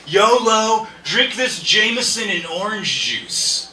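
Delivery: loud, drawn-out party cheer; mock reckless enthusiasm; hyped-up goading. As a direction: egg on a friend. Acted friend goading with yolo.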